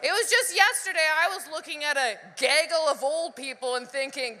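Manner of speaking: usual speaking voice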